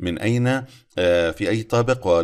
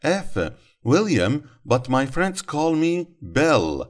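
slow model reading; 6 semitones higher, much slower than usual. Shown as a demonstration. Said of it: "الآن اقرأ هذه السياقات".

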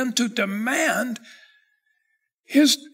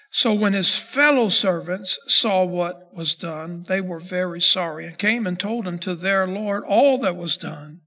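measured, lower Bible reading voice; scripture reading; 4 semitones lower than usual. Acted. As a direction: read the parable steadily and solemnly. Reads verses thirty-one to thirty-two.

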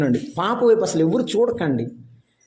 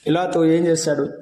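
ordinary talk

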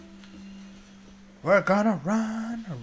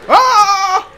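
high, loud scream; alarmed scream; 13 dB louder than usual. Real, not acted. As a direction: scream out as if attacked. Attacked in the game.

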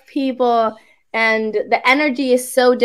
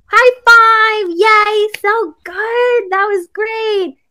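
high, louder cheering praise; praising kids; 9 semitones higher, 5 dB louder, slower than usual. Retold or imitated. Teacher imitating her praise for kids: excited. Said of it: "for kids, it's more like".

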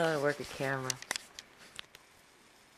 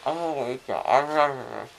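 talking through a bleeding mouth; words round gauze and blood; bloody mouth; 13 dB louder, faster than usual. Real, not acted. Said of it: "Look, your mouth is bleeding".

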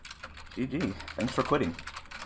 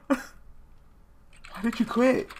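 laughing; 5 semitones higher, 5 dB quieter, much slower than usual. Real, not acted. Laughing disbelief at quitter.

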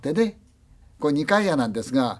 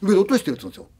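usual speaking voice

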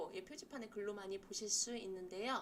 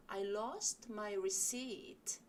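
slow model sentence; much slower than usual. Shown as a demonstration. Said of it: "lost는요, 입이 큰 어 소리예요".

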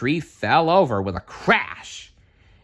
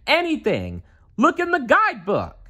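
much higher urgent call; high desperate call; 14 semitones higher than usual. A narrator's reading. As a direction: shout to the nephews from the rock. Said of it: "he called to his nephews".

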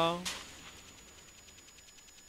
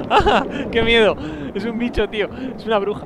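high, loud scared cry; frightened scream; 8 semitones higher, 6 dB louder than usual. Real, not acted. Streamer spotting the creature in the water, high loud frightened cry. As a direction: scream as the monster appears.

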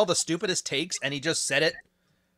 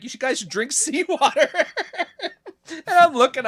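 laughing; 13 semitones higher, 6 dB louder than usual. Real, not acted. Mocking guru, laughing.

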